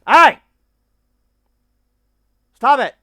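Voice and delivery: shouting at the barking dog to stop; yelling at the dog. Real, not acted. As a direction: bark a stop command across the room. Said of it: "let me yell at this damn dog".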